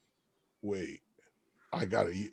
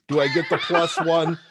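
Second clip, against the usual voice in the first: ordinary delivery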